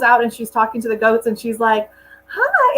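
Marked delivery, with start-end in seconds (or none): 2.3-2.7 high squeaky puppy-talk hi. Retold or imitated. Imitating a YouTuber greeting her goats in puppy voice. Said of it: "the puppy voice".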